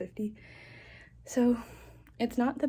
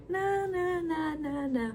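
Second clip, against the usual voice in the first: high singsong brush-off, sliding gradually down; mock refusal; 7 semitones higher, slower than usual. Heroine turning down his invitations, mock singsong refusal. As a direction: imitate someone waving off an offer in a singsong.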